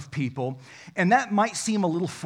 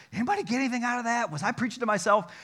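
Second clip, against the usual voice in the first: higher and fast, a self-mocking sigh of doubt; mock despair; 8 semitones higher, much faster than usual. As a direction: toss it off like a deflated preacher. Pastor wonders after preaching.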